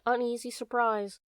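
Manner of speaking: usual speaking voice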